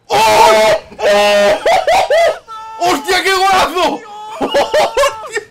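high loud stretched amazement; amazed shouting. Real, not acted. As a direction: shout it slowly in awe. Amazed at great goal.

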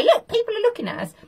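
high, fast squeaky whine; squeaky woman voice. Retold or imitated. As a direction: squeak it out, embarrassed. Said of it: "as if to say".

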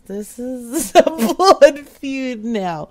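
slow, breaking up with real laughter; laughing. Real, not acted. Prank caller reacts to angry voicemail, laughing. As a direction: laugh through the words.

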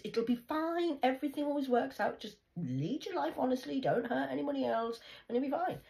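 high, firm grandmotherly voice; lightly voiced grandma telling her to stop worrying; grandma's voice. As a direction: lift the pitch and lecture like grandma. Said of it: "She used to say, talk like that".

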